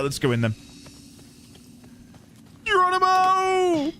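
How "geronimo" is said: mock battle cry. Jumping into the basement.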